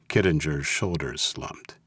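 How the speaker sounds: ordinary delivery, nothing marked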